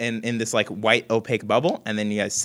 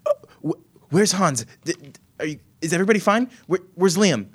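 higher, louder anxious questions; worried; 9 semitones higher, 4 dB louder than usual. Acted. Greta asking Guntar about missing friends, worried and higher. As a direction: ask in a rush of worry. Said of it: "She says".

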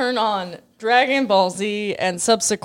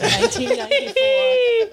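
laughing; 14 semitones higher than usual. Real, not acted. Laughs while naming the year.

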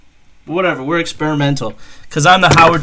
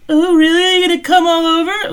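much higher, squealing mock voice; hammed-up high falsetto come-on; mock flirty sex worker; 7 dB louder than usual. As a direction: pitch way up like a giggly woman on the road.

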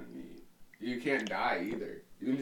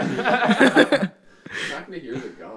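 laughing; 7 semitones higher, 14 dB louder, slower than usual. Real, not acted. Protesting through laughter.

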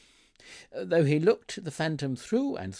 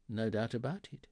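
ordinary delivery, nothing marked

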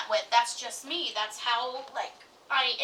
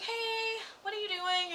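high, soft retold phone hello; lilting, higher-pitched hello; phone greeting; 8 semitones higher, 5 dB quieter than usual. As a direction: greet her sweetly high, a little softly. Phoning the partner's mother, retold.

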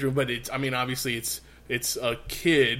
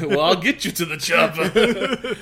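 slow laughing Arnold impression, pitched up; Arnold Schwarzenegger; 4 semitones higher, 4 dB louder than usual. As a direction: ham up the action hero line. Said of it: "Arnold Schwarzenegger".

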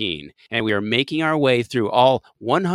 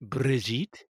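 slow, softer French accent; 6 dB quieter, much slower than usual. Shown as a demonstration. Host trying the actress's name for his co-host, French accent. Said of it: "say with your French accent".

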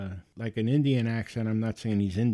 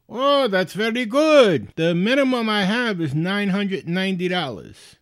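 loud, high, hammed-up delight; delighted scam caller; 8 semitones higher, 7 dB louder than usual. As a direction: crow like a con man landing his catch. Scammer delighted at the sum offered.

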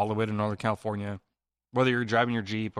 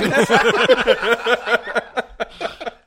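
loud laugh; 11 semitones higher, 10 dB louder than usual. Real, not acted. Big laugh at the joke.

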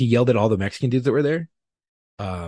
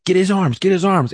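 high, loud urgent shout; calling out; 11 semitones higher, 5 dB louder than usual. Retold or imitated. Officer shouting to his partners during the arrest, loud and urgent. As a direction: yell the order to your partners.

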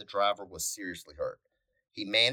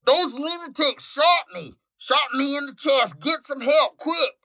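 loud, high wounded yell; 13 dB louder than usual. Acted. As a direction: shout it through the pain into the mic. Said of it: "yelled the wounded trucker".

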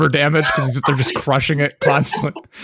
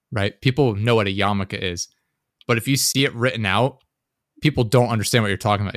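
usual speaking voice

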